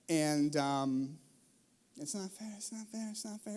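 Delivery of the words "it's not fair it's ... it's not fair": put-on soft, repeated kid complaint; muttered whine. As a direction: mutter it under your breath. Kids still repeating complaint.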